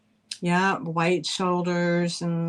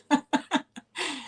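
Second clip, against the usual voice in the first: laugh; 7 semitones higher, 3 dB quieter than usual. Real, not acted. Laughing at own joke.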